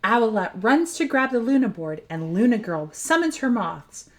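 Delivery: ordinary delivery, nothing marked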